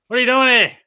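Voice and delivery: high, loud shocked question. Real, not acted. Streamer shouts at the friend lurking outside his window, shocked. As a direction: blurt it out in total shock.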